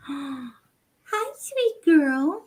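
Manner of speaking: high-pitched pet talk. Real, not acted. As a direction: coo a greeting to your pet. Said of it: "I hear the baby voice".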